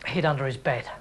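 usual speaking voice

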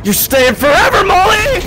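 high, loud wailing threat; deranged scream. Acted. Seeker tells friend he's never leaving, deranged scream. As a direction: erupt into a crazed shriek.

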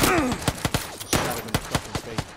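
slow, loud victory yell; triumphant shout. Real, not acted. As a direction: draw it out in triumph. Triumphant after a kill.

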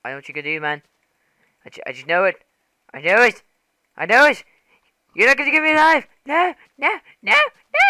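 frightened pig pleading for its life; voicing a scared pig. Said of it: "The pig's like".

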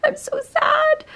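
very high, slow mock wail; mock crying. Lamenting to viewers her disliked light, mock crying. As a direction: wail it in a tiny, hammed-up crying voice.

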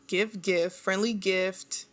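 ordinary delivery

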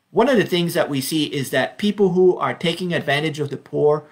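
ordinary talk, nothing marked

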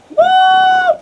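high whooping cheer. Real, not acted. Gamer celebrating on stream, loud whoop. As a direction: let out a loud victorious whoop.

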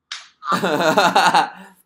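high, very loud burst of laughter; long real belly laugh. Real, not acted. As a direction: crack up at the clip.

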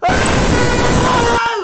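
mock high scream, very loud; imitating a crazy scream; imitated scream. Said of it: "you can hear".

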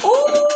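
high, drawn-out "ooh"; delighted whoop. Real, not acted. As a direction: whoop with glee into a laugh. Delighted after blowing over bottles.

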